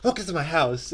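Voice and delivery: Tai Lopez ad voice. Retold or imitated. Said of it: "he's like".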